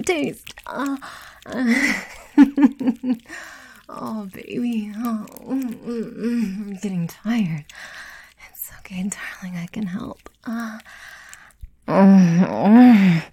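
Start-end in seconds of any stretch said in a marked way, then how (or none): 2.0-3.5 quick, higher, louder chuckle; teasing chuckle. Acted. Amused by the partner's reaction. 11.9-13.2 loud, drawn-out closed-mouth groans; pleasured groan. Acted. Intimate scene, wordless moaning.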